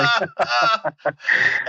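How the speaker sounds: high laugh falling away; amused laugh. Real, not acted. Storyteller finishing his first deer kill tale, real laugh. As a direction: laugh heartily at your own punchline.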